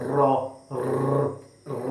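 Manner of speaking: র trilled hard with the tongue; tongue drill; rolled r. Shown as a demonstration. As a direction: move the tongue a lot for র. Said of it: "এটাকে নাড়াচাড়া করতে হবে বেশি করে".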